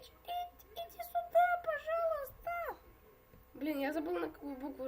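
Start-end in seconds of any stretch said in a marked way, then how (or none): 0.0-2.8 high sing-song pleading, slow at the end; high coaxing voice. Acted. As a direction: coax him in a high voice. Coaxing a player to come closer.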